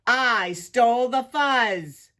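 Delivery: loud, slow villain's boast; big, drawn-out proclamation; barked. A narrator's reading. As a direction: bark it from above, stretching it out. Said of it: "barked a voice from above".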